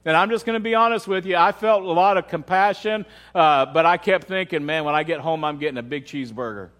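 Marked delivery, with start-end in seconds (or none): 4.7-6.7 low, quicker aside, dropping away; confiding inner thought. Retold or imitated. Preacher admitting a private craving to congregation, confiding. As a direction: let it slip out low and soft.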